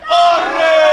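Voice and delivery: loud, drawn-out announcer yell; winner announcement shout. Real, not acted. Host reveals winner after the countdown, shouting. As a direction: shout the winner's name and hold it.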